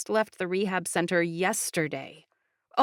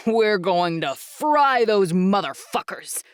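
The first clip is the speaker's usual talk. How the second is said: slow, louder growled threat; menacing growl; 5 dB louder, slower than usual. Acted. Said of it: "a Coigny guy growled".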